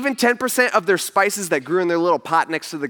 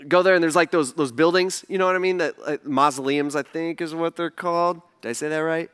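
ordinary talk, nothing marked

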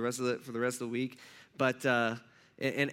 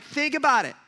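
higher and louder, pressing the point; logical challenge; 10 semitones higher, 7 dB louder than usual. Retold or imitated. James challenging the church, loud pressing challenge. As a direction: make them think, punch it out. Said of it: "He's just like".

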